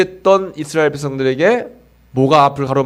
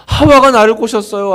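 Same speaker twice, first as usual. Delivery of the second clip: higher, louder defensive blame; Adam pinning it on Eve, higher and louder; Adam blaming; 6 semitones higher, 4 dB louder than usual. Retold or imitated. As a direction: point the finger like a caught child. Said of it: "하와를 탓했어요".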